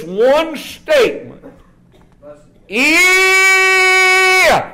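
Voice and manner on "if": high, loud sudden cry of "If"; preaching cry. Real, not acted. Preacher calling out to the church, high and loud.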